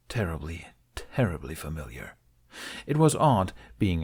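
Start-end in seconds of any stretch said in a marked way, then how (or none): 0.1-2.2 soft unvoiced whisper of a private thought. Ben murmurs his inner dread in a whisper. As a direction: breathe it to yourself.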